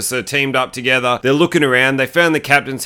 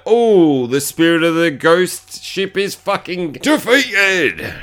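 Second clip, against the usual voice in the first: slow, drawn-out mock pronouncement; mock solemn proclamation; 3 semitones higher, slower than usual. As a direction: stretch it out like a hammy movie line. Mock line as ship dies.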